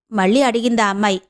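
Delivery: ordinary delivery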